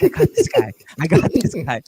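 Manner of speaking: high, loud mock-gleeful crowing; happy gloating. Bettor imitating Jalik happy about the bet, happy gloating. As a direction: crow it like an overjoyed rival, pitch starting way up. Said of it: "But Jalik's, like, happy".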